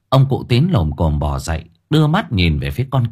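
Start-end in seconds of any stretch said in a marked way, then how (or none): none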